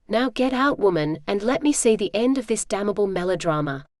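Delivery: ordinary delivery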